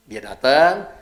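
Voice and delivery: ordinary talk, nothing marked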